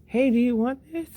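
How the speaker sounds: high, loud, eager offer; imitating generous child. Son giving away his lunch food to other kids, imitated eager offer.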